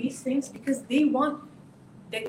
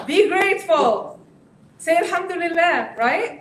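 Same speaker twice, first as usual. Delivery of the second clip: lecturing parent; 7 semitones higher, 8 dB louder than usual. Retold or imitated. Parent lecturing the teen, preachy and loud. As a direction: hammer home a pious lecture. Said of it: "be like".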